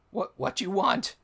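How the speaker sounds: a hint of a wary little kobold asking; broken Goblin kobold. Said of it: "speaking back to you in Broken Goblin".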